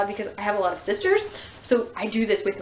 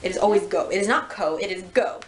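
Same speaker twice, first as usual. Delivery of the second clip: usual speaking voice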